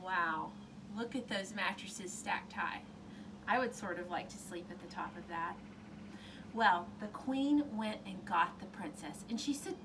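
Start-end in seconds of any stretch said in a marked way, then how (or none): 0.0-5.6 put-on cheerful counting for children; kids show host.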